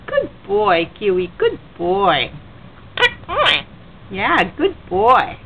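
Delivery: very low, slow praise; deep voice. Real, not acted. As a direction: rumble it from the chest. Deep praise to pet bird.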